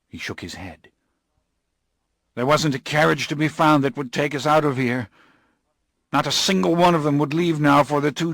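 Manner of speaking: a frightened man insisting; agitated Morgan. Acted. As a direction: Morgan begging for help. Morgan recounts fleeing.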